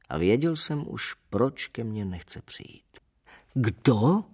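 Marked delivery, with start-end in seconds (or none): 3.5-4.2 short raised-voice demand; sharp narrated shout; roared question. A narrator's reading. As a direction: roar it, moderately held back. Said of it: "zařval jsem ještě jednou".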